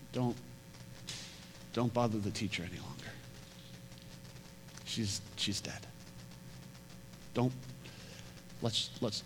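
soft, hushed, delivering grim news; hushed bad news. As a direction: quietly break the death news. Messengers bring news of death.

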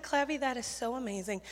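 soft, sincere praise; sincere affirmation. Retold or imitated. Camp leader affirms Clavy's missionary calling, sincere affirmation. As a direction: affirm the child softly and earnestly. Her own calmer reply to Clavy.

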